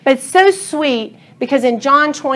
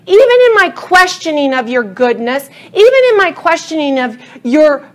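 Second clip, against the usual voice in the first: heartfelt prayer; 3 semitones higher than usual. Acted. Preacher praying aloud to God, building from hushed to fervent. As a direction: pour it out to God, building to a passionate cry. Voices Peter's prayer, then her own.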